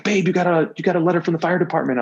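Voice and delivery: high, fast, breathless announcement; excited wife news. Retold or imitated.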